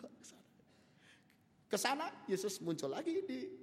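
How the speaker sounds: normal talking voice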